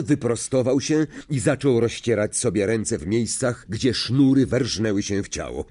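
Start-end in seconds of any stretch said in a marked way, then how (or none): none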